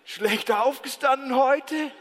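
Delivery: slow, booming amused voice; God answers the angry shouter with slow jovial calm. Acted. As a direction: laugh it off slowly and kindly. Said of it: "Und Gott sagt".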